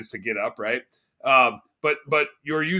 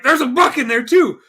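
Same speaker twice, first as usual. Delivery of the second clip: high, loud burst of excitement; excited exclamation; 10 semitones higher, 10 dB louder than usual. Retold or imitated. Hunter exclaiming to buddies about a buck, excited. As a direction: call it out as if just spotting game. Retelling the moment they spotted deer.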